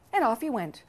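ordinary delivery

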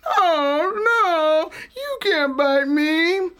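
high, whimpering protest; frightened pleading. Acted. Possum begging Snake not to bite, high and scared. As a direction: beg in fear.

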